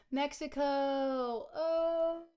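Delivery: loud, high discovery; excited exclamation. Real, not acted. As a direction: blurt it out in triumph. Spotting the country clue.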